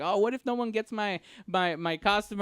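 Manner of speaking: ordinary talk, nothing marked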